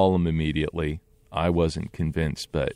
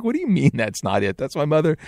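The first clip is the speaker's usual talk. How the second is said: disbelieving question; 10 semitones higher, faster than usual. Retold or imitated. Confronting the psychic in disbelief.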